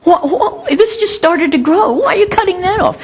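high, pleading protest; mock protest to God. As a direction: whine at God for cutting the shoot. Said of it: "And you say".

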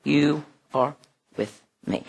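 "You are with me" repeated in a hush; hushed echo. Shown as a demonstration. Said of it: "this one is".

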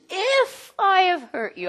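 mock apology with a long, high-pitched IF; excuse-making IF. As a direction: stretch IF way up high to expose the excuse. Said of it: "That's an excuse".

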